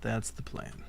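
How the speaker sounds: whispered to himself; whispering. Real, not acted. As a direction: breathe it as a soft whisper. Whispered repeat of the plan.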